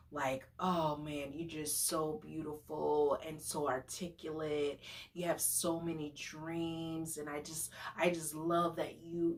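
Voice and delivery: soft, low imitation of a smitten date; laying it on thick. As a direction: murmur flattery like an overeager suitor. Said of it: "he just kept laying it on thick".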